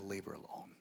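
very soft, quiet command; gentle hush. Retold or imitated. Jesus defending the woman, soft and firm. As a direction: say it softly but firmly. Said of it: "And he said".

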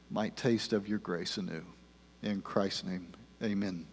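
soft, measured congregational prayer. Real, not acted. Pastor prays before preaching, hushed prayer. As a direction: pray quietly, pausing between phrases. Let's pray.

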